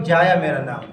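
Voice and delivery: imitated name-holder saying "جایا", pitch dropped; mispronouncing own name. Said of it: "جس کا نام یحییٰ".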